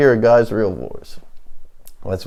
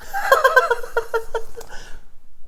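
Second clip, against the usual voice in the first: high, quiet cackle; maniac laugh; 7 dB quieter than usual. Real, not acted. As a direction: giggle helplessly, high and soft. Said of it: "No laughing like an insane maniac".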